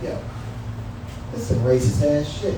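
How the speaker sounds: ordinary talk, nothing marked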